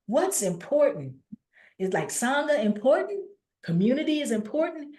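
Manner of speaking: eager questioner. Retold or imitated. Voices a follower questioning Buddha.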